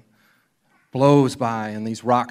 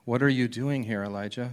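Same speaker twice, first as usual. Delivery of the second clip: ordinary talk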